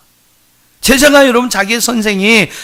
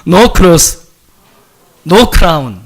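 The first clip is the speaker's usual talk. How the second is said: usual speaking voice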